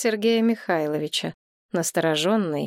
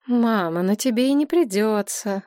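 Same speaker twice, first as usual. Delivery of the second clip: ordinary delivery, nothing marked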